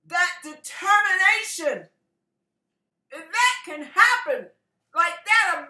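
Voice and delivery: high, loud preacher challenge; fervent exhortation. Real, not acted. Preacher urging the congregation to pursue God, fervent exhortation. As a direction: press the congregation with rising fervor.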